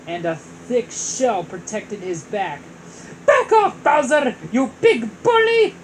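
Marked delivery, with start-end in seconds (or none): 3.3-5.7 high, loud defiant yell. Acted. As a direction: shout it at the bully. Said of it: "Mario yelled".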